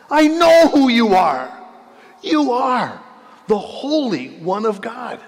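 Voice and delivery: high, loud, shrieking demon voice; possessed man shrieks at Jesus, loud and high; demon crying out. Acted. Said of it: "cried out".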